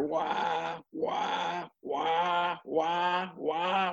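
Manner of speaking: repeated high wahs, soft and climbing; hyrax call crescendo. Retold or imitated. Copying the hyrax's rising night call, repeated high wahs. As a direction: build the animal cries one after another. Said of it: "they go in a crescendo".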